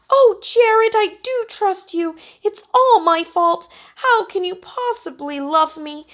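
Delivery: high, loud melodramatic soap actress; soap opera heroine. A narrator's reading. Heidi watches a TV drama: hammed-up soap heroine. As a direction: overact the tearful TV confession. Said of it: "TV drama heroine".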